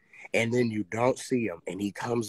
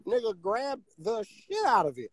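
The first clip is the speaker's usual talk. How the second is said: high-pitched, animated disbelief; worked-up retelling; 8 semitones higher, 7 dB louder than usual. Real, not acted. Telling friends about his snatched hat, worked-up and high. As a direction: tell it all worked up, voice riding high.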